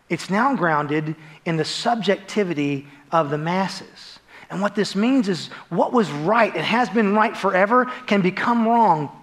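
normal talking voice

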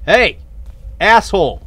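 sharp angry shout at the enemy; angry yell. Real, not acted. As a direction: yell the insult out. Yelling at a game enemy.

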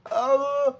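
high shriek of no; panicked screech; scream. Real, not acted. In-game disaster.

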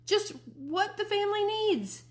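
बहुत ऊँचे सुर में तंज़ भरी पुकार; ताना मारते हुए ऊँचा चिल्लाओ; sarcastic shout. Acted. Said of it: "I kept right on shouting".

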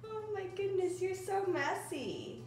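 delighted giggling mom. Real, not acted. Mother laughs at messy toddler.

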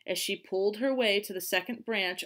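usual speaking voice

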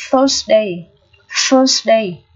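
"Thursday" opened with a voiceless TH; tongue between teeth. Shown as a demonstration. Teacher demonstrates TH for learners, carefully modelled. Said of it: "phát âm là lưỡi giữa răng".

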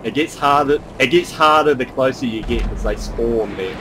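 normal talking voice

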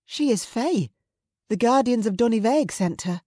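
high-pitched, urgent explanation; anxious insistence. Acted. Mother explaining the girl to the raider, anxious. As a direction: insist on it to protect her.

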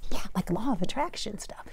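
soft whispered aside. Real, not acted. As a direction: slip it in as a hushed aside.

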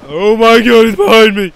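sudden panicked yell; real startled shout, loud; panicking gamer. Real, not acted. Enemy suddenly attacks from behind.

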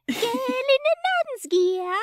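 high, slow, laughing Cockney; weird Cockney. Retold or imitated. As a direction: squeak it in a bad Cockney. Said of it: "high-pitched and weird like that".

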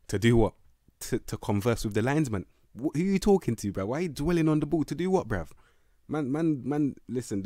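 exasperated shouting at the screen; incredulous rant. Real, not acted. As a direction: demand answers from a useless player. Ranting at a bad player.

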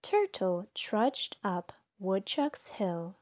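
plain reading voice

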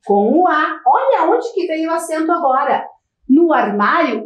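ordinary talk

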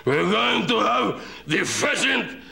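high, drawn-out exaggerated impression; mock grand host. Comedian mimicking a British host, hammed-up high slow call. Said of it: "he's like the Sydney Grinch. He'd go".

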